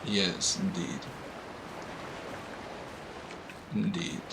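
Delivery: lightly voiced pondering sage, hushed; philosopher voice. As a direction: muse like a mild armchair philosopher. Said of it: "going into my philosopher voice".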